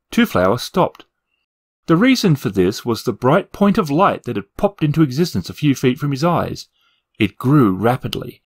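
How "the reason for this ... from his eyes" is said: usual speaking voice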